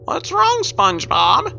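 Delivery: put-on high girlish voice, lightly concerned; concerned Pearl. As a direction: ask gently in a high young girl's voice. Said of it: "Pearl says in a concerned tone".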